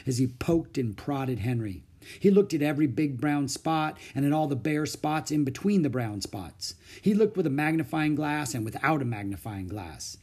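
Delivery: ordinary delivery, nothing marked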